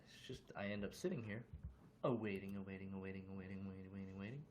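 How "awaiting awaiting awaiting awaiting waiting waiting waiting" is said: low, soft repetitive monotone; tedious waiting. Pretend. Streamer stuck waiting for viewers, tedious repetition. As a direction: repeat it softly on one low note, trailing on.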